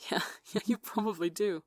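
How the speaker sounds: laughing through tears, relieved. A narrator's reading. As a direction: let the relief spill into laughter. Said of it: "Arson let out a relieved laugh".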